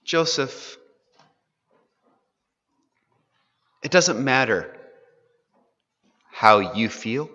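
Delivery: a father gently breaking hard news to his son; tender father. Acted. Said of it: "It's like he's saying".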